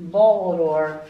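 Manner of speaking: ordinary delivery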